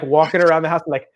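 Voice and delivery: normal talking voice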